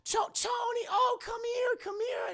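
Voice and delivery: very high, giddy, laughing call; gleeful greeting. Acted. Joan waving Toni over in parking lot, giddy high call. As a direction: beckon her over, delighted. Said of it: "she's laughing, having a great time".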